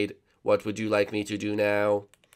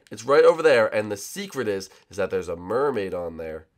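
ordinary delivery

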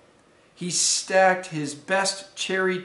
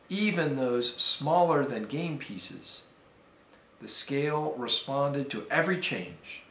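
usual speaking voice

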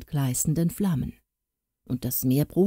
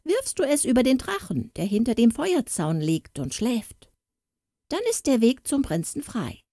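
higher-pitched storybook gnome, lightly acted; lightly voiced gnome giving instructions; 5 semitones higher than usual. A narrator's reading. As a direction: lightly play a gnome advising the heroine, pitched up.

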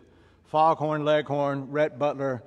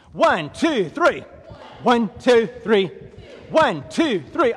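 loud, high exercise count; drill cadence; 5 semitones higher, 6 dB louder, slower than usual. Real, not acted. Trainer counting side straddle hops for class, shouted cadence.

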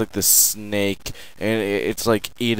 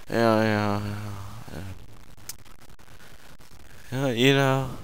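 ordinary delivery

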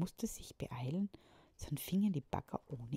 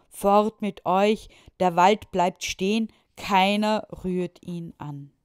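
much louder, slow commanding yell; furious shout; 3 semitones higher, 14 dB louder, slower than usual. A narrator's reading. The mayor yells at the digger drivers to leave. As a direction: bellow to protect the forest. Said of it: "rief der Bürgermeister so laut er konnte".

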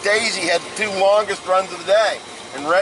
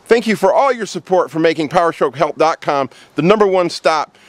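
ad read; 2 semitones lower, 6 dB louder than usual. Acted. Sponsor plug read to camera.

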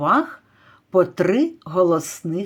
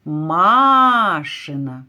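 машина with the first А held long; drawn-out stressed А; much slower than usual. Shown as a demonstration. Teacher shows which vowel stretches in машина, holding first А. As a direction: hold the МА of машина, then let the rest fall. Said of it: "який голосний тягнеться?".

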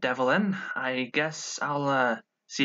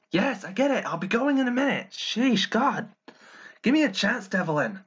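higher-pitched, exasperated protest; fed up with being rushed; 8 semitones higher than usual. Acted. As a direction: snap back at a nagging brother. Snapping at brother rushing him.

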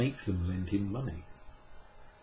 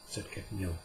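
usual speaking voice

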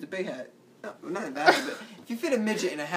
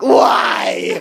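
loud, drawn-out whiny "why"; exaggerated whine, stretched and loud; whining; 3 semitones higher, 12 dB louder than usual. Shown as a demonstration. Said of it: "That was more like whining".

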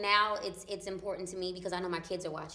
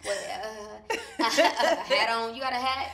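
laughing, pitched up, protesting; half-laughing mock outrage; 4 semitones higher, 8 dB louder than usual. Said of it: "I was like".

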